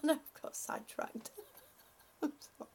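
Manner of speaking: soft, giggly apology; giggling apology. Real, not acted. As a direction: say it softly through giggles. Giggling after losing her thread.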